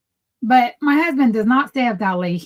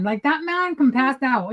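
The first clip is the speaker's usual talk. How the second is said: ordinary delivery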